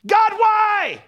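put-on very high, loud child's protest to Dad; hammed-up shouting at the unfairness; contemptuous complaint. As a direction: yell it like an acted tantrum, stretching the last lines. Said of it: "It's like this contempt".

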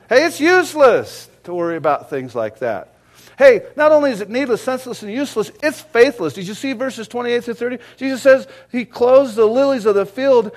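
loud, high preaching voice; rising sermon fervor; fiery preaching. Real, not acted. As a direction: preach it hard to the congregation. Preaching at full force.

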